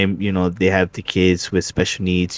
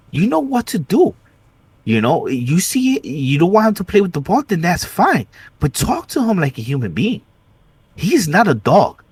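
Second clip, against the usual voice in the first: higher, heated retelling of telling off the teacher; retold angry scolding; 9 semitones higher than usual. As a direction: scold her like you're reliving it.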